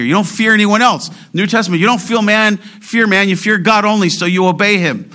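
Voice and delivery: high-pitched, forceful exhortation; impassioned preaching. Real, not acted. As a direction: drive each phrase home fervently.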